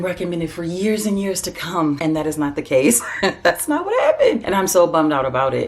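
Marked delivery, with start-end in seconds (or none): 3.1-4.5 high-pitched, laughing through the words; real amused disbelief, breaking into a laugh. Real, not acted. Laughing at her own letdown.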